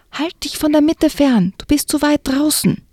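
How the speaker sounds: calling out a warning. Acted. Will calling to the girl far out on the ice, urgent call. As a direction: call across the ice to pull her back.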